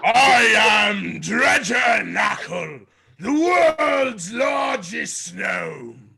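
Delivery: high, very loud, stretched-out proclamation; boastful bellow. Acted. Dredger handing out signed photos to drinkers, boastful bellow. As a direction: bellow it slowly like a showman's boast. Boastful self-announcement to fans.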